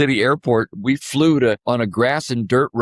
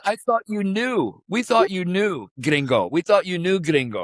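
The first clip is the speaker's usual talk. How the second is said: higher, easygoing imitation of the clerk; the clerk shrugging it off, voice pitched up; front desk clerk; 8 semitones higher than usual. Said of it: "He says".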